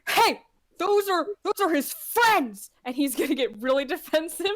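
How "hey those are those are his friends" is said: slow, higher indignant cry; a dwarf standing up for a friend; protective shout. Acted. Theon defending the druid's bug friends, slow indignant cry. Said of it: "sees this interaction happen and says".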